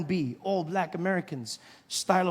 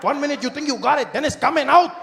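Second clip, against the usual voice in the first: put-on rising louder Mexican-accented warning; 5 semitones higher, 6 dB louder than usual. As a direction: vendor warns about taco mess, Mexican accent. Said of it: "He says".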